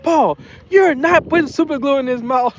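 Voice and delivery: high imitation of an appalled wife; hammed-up spousal telling-off; mock scolding. Voices wife objecting to the plan.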